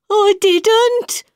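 high, slow hare; high quick-tempered hare; March Hare voice. A narrator's reading.

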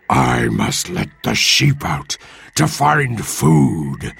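slow, drawn-out monster; Cyclops voice. Acted. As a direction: stretch each word like a lumbering giant. Cyclops speaks in the story.

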